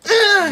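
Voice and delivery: very high, loud strained cry of effort; high strained grunt. Retold or imitated. Mimicking the chest light blinking, high loud strained grunt. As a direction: yelp like you're being squeezed. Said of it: "Just feels like".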